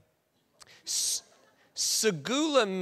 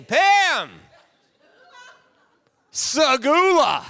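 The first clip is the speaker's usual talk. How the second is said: louder, higher, slow segula; grand declaration; 9 semitones higher, 8 dB louder, much slower than usual. Acted. Pastor at her door, declaring segula loudly. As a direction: make it a big romantic moment. Romantic proposal on a date.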